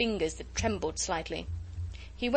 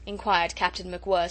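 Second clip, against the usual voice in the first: usual speaking voice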